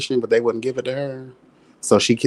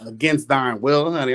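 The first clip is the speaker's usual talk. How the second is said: ordinary delivery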